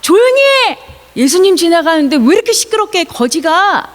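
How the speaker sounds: high, acted angry telling-off; scolding shout. Crowd rebuking the beggar as Jesus passes, scolding shout. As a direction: start high and sharp, then rush the complaint. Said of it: "소리를 질러서 이 사람한테".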